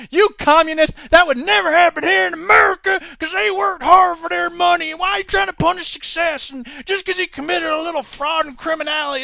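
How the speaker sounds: loud, high, indignant sarcasm; mock outraged American capitalist. Mocking American defender of bankers, loud and outraged. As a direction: rant like a blustering patriot defending bankers.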